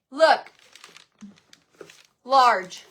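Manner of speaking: ordinary talk, nothing marked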